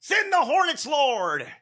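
high mocking battle-cry prayer; mock preacher. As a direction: pitch it way up like a zealous radio host. Mocking the radio host's catchphrase.

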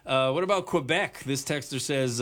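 ordinary delivery

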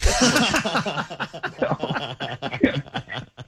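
long high laugh, breaking up at the punchline; hearty real laugh. Real, not acted. Friend's Woodstock punchline.

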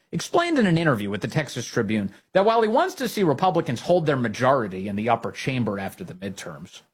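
ordinary delivery

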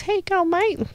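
very high parrot imitation; a high talking bird copying its owner. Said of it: "and he goes".

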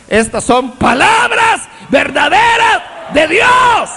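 slow, high, shouted proclamation; preaching climax. Real, not acted. Preacher rousing the congregation about the heavenly feast, shouted. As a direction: build it to a rousing peak.

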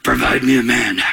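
mock-distressed king, low and labored. Preacher replaying Saul's order to his servants, distressed. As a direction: act out a troubled king's plea. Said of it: "he was distressed".

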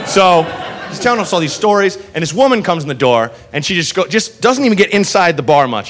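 ordinary talk, nothing marked

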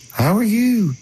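very high, dreamy grandfather; soft, gentle. Retold or imitated. Dying grandfather greeting his grandson, soft and very high. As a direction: float it high, unthreatening and gentle. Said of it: "Never heard him sound so soft".

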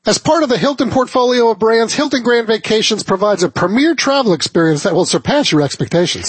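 polished sponsor read, lifted at the start; radio ad read. Acted. Host presents the vacation sponsor to listeners, radio ad read. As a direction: sell it like a radio host reading copy.

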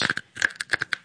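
soft, sudden gasp of surprise; quick astonished gasp; real gasp. Real, not acted. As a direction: catch your breath in amazement. Sees the flash in closet.

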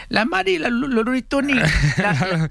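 a teasing high-pitched imitation of a friend singing; high mocking singer voice. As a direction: mimic the singer in a squeaky high voice.

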